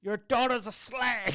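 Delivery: mock tannoy announcement.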